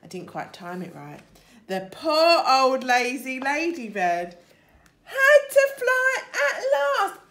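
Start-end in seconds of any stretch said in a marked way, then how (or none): 1.7-7.2 excited storytelling. Acted. Ladybird falls and flies.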